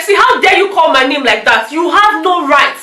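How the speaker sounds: offended housemate demanding respect, mock angry; indignant. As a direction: tell her off, outraged she dares. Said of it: "Katrina was like".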